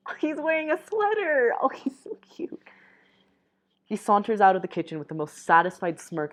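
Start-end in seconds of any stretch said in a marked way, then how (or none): 0.1-1.5 much higher, giddy squeal; gushing fan reaction; delighted squeal. Real, not acted. Reader's gushing aside. 1.6-2.6 breathy whispered squeal; hushed adoring aside; whispered gush. Real, not acted. Reader's gushing aside.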